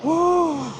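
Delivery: high, sudden whoop of delight; excited whoop. Real, not acted. Vlogger cheers at the Oscars red carpet, excited whoop. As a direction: let out a bright cheer.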